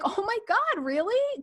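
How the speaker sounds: very high-pitched, acted-out surprise; mock astonishment. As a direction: play up the disbelief in a squeaky-high voice. Acts own surprised reply to friends.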